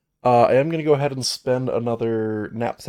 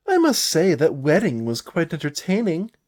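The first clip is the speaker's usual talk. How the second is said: higher, louder theatrical bard voice; lilting, showy bard; playful bard; 6 semitones higher, 6 dB louder than usual. Acted. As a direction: tease as an outgoing storyteller. Voicing the bard NPC's line.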